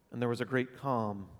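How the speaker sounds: usual speaking voice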